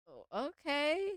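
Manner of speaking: ordinary delivery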